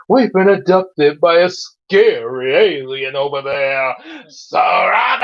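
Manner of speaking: hammed-up panicked cry, climbing at the end; screaming. Zay warns friends of a scary alien, mock screaming. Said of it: "and quickly ran to Gabe and Tyrone screaming".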